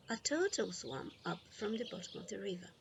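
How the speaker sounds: ordinary delivery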